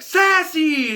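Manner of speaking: high, loud joyful shout of a name; excited call. Retold or imitated. The family calls out to their returning cat, high and loud.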